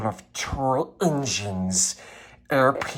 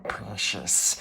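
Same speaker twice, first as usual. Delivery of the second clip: lower, soft hissing aside of precious; creepy creature muttering to himself; Gollum aside; 5 semitones lower, 7 dB quieter than usual. Acted. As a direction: murmur it to yourself like Gollum. Gollum aside while reading.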